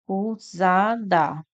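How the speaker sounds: acusada slowed right down, syllable by syllable; a-cu-sa-da, each syllable separate; split into syllables. Shown as a demonstration. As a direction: pull acusada apart into a-cu-sa-da. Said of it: "nós temos a".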